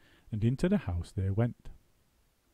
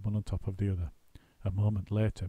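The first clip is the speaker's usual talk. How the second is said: plain reading voice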